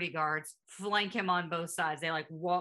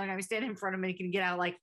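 ordinary talk, nothing marked

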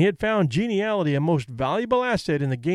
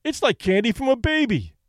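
proclaiming; 7 semitones higher than usual. Acted. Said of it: "Danny's manager proclaimed".

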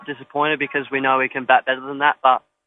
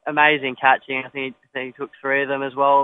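ordinary delivery

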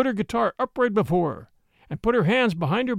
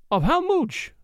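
put-on high Spanish-accented question; puzzled childlike Spanish accent; broken English; 9 semitones higher, slower than usual. A narrator's reading. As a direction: ask in high, infantine foreign English. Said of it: "in a broken English that seemed as much infantine as foreign".

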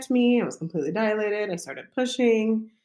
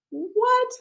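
shocked what; 4 dB louder than usual. Retold or imitated. Shocked reaction to news.

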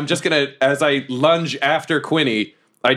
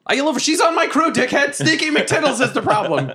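high-pitched acted outburst; hammed-up high, protesting yell; indignant pirate protest. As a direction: argue frantically in character with an attacker. Pretend pirate protests during attack.

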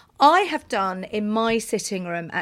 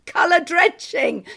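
slow, loud, high-pitched announcement of the title; panel-game title repeat; 8 semitones higher, 6 dB louder, slower than usual. Pretend. Host playing a radio panel game, mock-grand slow announcement. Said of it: "You're allowed to repeat the title".

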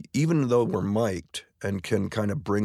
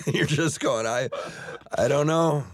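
ordinary delivery

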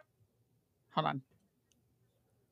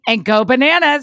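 put-on Georgian accent; 3 semitones higher, 5 dB louder than usual. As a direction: echo the caller in a Georgian accent. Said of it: "and what a Georgian".

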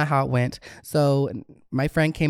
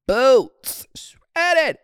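high, loud disbelieving cry of boots, shredded; exaggerated shocked squeal; 11 semitones higher, 4 dB louder than usual. To viewers about her blown tire, high shocked squeal.

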